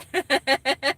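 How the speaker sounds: giggle. Real, not acted. Laughing at her own attempt.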